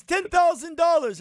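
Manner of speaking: high, loud haggling price; rug seller. Retold or imitated. Seller quoting a tourist price, high and loud. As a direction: announce the price like a greedy merchant.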